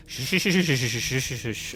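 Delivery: steady shh like calming a horse; creepy gentle shushing; soothing shushing. Acted. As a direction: hush him as you stroke a spooked horse. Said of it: "It's like, like".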